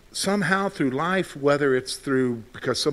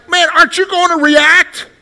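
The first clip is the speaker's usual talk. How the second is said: high, loud impatient demand; much higher and louder, pleading for a reaction; exasperated outburst; 11 semitones higher, 11 dB louder than usual. Retold or imitated. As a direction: blurt it out, fed up with the silence. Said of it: "then you're like".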